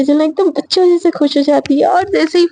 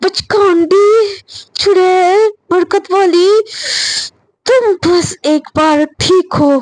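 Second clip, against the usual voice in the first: higher, drawn-out name-calling; slow cursing; 4 semitones higher, much slower than usual. Acted. Shreya cursing Sejal to herself in anger, slow seething insults. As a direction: curse word by word, stretching each insult. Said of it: "धीरे-धीरे फिर से गालियाँ देती है".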